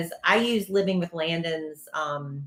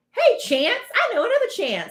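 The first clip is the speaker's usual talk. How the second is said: high, loud, chirpy hello; perky acted small talk; retold friendly greeting; 4 dB louder than usual. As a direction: greet a stranger with bubbly, high-pitched enthusiasm. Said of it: "I sit down and I'm like".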